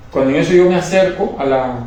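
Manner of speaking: ordinary delivery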